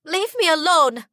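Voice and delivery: a sister screams for help. A narrator's reading. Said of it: "a familiar voice screams".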